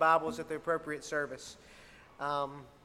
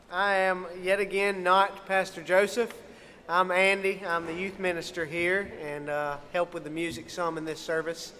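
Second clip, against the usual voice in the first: ordinary talk, nothing marked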